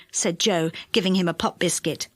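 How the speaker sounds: ordinary delivery, nothing marked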